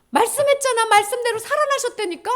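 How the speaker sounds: high, loud insistence; insistent angels. Acted. Angels telling women he rose, high insistent. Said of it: "천사들이 뭐라고 얘기해줬어?".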